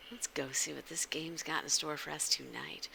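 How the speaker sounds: hushed teasing whisper; conspiratorial stream whisper. Real, not acted. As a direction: whisper it like a secret. Unvoiced whisper teasing the game.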